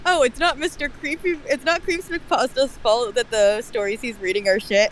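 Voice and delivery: usual speaking voice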